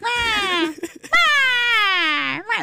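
high-pitched imitation of a crying baby; mock baby wail. Host mimicking the baby in the back seat, mock wail. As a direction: wail like a baby, half laughing. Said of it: "I see the baby like".

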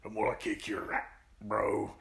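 a hint of a grunting tough guy; lightly voiced meathead threat; deep grunted voice. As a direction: grunt it out like a brawler. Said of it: "the deep grunted voice".

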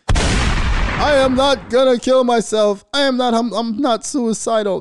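high, loud, slow shrieking plea; mocking a frantic denial, pitched high and loud; mock hysterical plea. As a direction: wail it like a panicked man begging for his life. Mocking Jussie Smollett's plea.

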